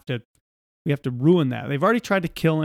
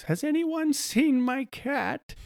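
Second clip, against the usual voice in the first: put-on much higher, softer and slow, a looping little old lady; Phyllis the NPC; 11 semitones higher, 5 dB quieter, slower than usual. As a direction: ask it like a lost, repetitive background character. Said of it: "she takes him to Phyllis".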